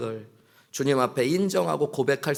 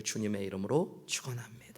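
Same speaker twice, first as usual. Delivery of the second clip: soft unvoiced blessing; whispered closing blessing to the congregation; 3 semitones lower, 10 dB quieter than usual. Real, not acted. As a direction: breathe the blessing out.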